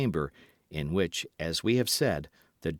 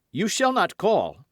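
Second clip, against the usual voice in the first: high, loud command; forceful prohibition; 10 semitones higher, 6 dB louder than usual. A narrator's reading. Felton keeps the Duke from the bell, forceful command. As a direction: forbid him sharply and urgently.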